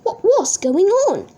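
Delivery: ordinary delivery, nothing marked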